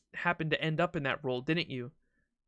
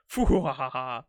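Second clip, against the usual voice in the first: haughty royal laugh; 2 semitones lower, 4 dB louder than usual. Acted. King laughs at their proposal.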